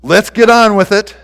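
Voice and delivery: loud, high, impatient dare; defiant challenge. A narrator's reading. Boy holds out his hands to the principal, defiant. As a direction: throw it out as a tough young rebel.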